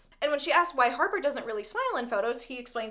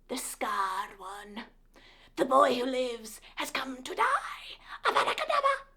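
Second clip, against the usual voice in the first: dark wizard villain; 3 dB quieter, slower than usual. Pretend.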